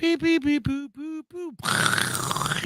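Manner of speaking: high, playful beep boop noises; beeping sound effects. Pretend. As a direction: make robot beeps up high. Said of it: "That's an explosion".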